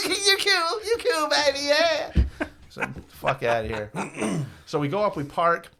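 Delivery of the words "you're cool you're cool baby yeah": very high, laughing through the words; laughing dealer. Retold or imitated. Dealer laughing off the joke, high and giggly. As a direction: giggle it out, delighted. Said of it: "And he's like, laughs".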